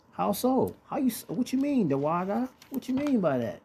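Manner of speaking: high-pitched mock questioner; puzzled questioner. Preacher acting out someone questioning him, high and puzzled. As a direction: put on a high voice querying the claim.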